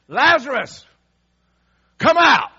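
huge, high-pitched shout; loud commanding call. Acted. Jesus calls dead Lazarus out of the tomb, shouting. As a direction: bellow it as a summons to the dead. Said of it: "Jesus called in a loud voice".

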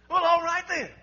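high, loud, quick preacher's delivery; playful exuberance. Real, not acted. Preacher joking with congregation after buying boat, exuberant.

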